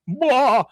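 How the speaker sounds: high, loud mock cry; mock cartoon scream; horrified yell. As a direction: blurt a high wordless holler. Hank cries out in horror.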